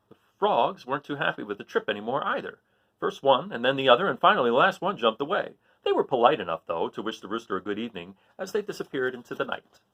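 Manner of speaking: lively read-aloud storytelling; story narration. A narrator's reading. Teacher reading a story to the class, lively narration. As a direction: read it like a picture book to kids. Reads a picture book aloud.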